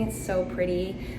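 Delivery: ordinary talk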